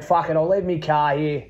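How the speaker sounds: ordinary talk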